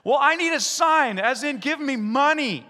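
high, loud pleading demand; mock demanding. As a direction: push for proof, a bit whiny. Believer demanding a sign.

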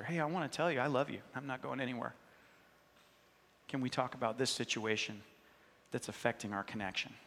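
tender voice raising a hard subject with a friend; gentle and tender. Acted. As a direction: ease into a hard talk lovingly. A gentle, loving confrontation is acted out.

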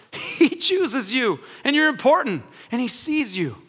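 normal talking voice

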